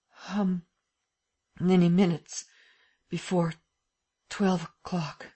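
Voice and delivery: slow, faltering question; weak and halting. A narrator's reading. Dazed Lupin asking the hour, faltering. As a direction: drag each word out feebly.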